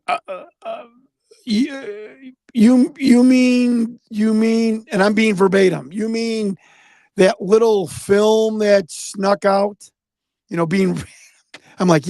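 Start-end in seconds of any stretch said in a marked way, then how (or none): 0.1-4.8 flustered stammer. Retold or imitated. Victor caught off guard, stammering. 5.9-9.9 flustered stammer. Retold or imitated. Victor caught off guard, stammering.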